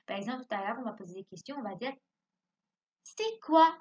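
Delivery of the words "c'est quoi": higher, loud asking tone; question tone. Shown as a demonstration. Teacher demonstrating a question, loud and high. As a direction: ask it with a lifted, loud voice. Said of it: "le ton n'est pas pareil".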